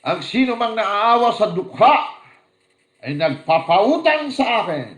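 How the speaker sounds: slow, high, loud proclamation; preacher booming the Lord's promise; proclaiming the Lord's words. Acted. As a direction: thunder out the scripture. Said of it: "Kaya sabi ng Panginoon".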